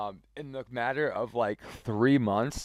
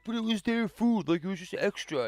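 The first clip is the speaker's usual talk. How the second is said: higher, whining mock voice; whiny excuse; 9 semitones higher than usual. Caught coworker whining his excuse to the boss, high mock whine.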